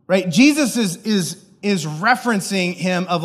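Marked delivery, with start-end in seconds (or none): none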